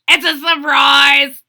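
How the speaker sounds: loud excited yell. Acted. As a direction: shout it out to mum. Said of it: "yelled Froggy".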